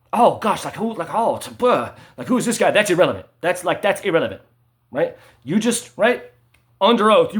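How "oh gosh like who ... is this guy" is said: mock fluster. Mocking an imagined objection.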